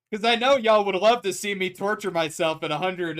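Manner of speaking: ordinary delivery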